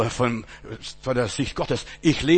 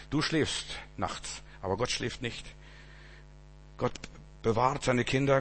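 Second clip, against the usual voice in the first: softer, gentle comforting sermon voice; soft reassurance; 4 dB quieter than usual. Acted. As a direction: comfort the congregation quietly.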